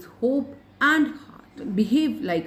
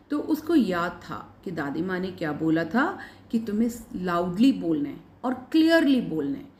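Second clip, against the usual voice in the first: ordinary talk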